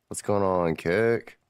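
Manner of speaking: rising shouted hello; loud greeting. Real, not acted. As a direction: call out the greeting. Streamer greeting platforms.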